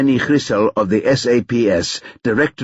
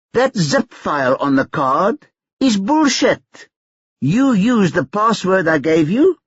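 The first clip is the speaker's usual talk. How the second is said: put-on higher, brash young hustler taunting on the phone; cocky Tyrone; 8 semitones higher than usual. A narrator's reading. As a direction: needle him, sure you hold the cards.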